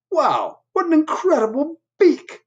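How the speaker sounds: high, far-off admiring voice; high admiring Cat; impressed Cat. Acted. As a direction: marvel at the beak as Cat. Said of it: "said Cat".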